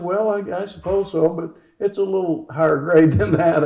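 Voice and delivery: high put-on patient's voice; acted hesitant correction, high; imitated patient's demurral. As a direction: pitch it up as the patient politely correcting. Voices patient's slightly huffy correction.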